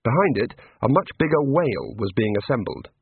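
ordinary delivery, nothing marked

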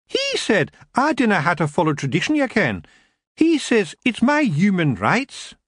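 put-on high Scots girl, slowing to insist; a wee girl quoting her rights; defiant lassie.

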